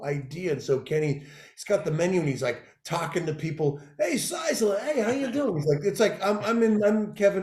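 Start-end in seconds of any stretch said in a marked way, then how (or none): 4.0-5.5 Kenny's hearty greeting. Retold or imitated. Voicing Kenny greeting people.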